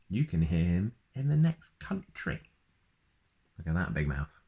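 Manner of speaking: ordinary delivery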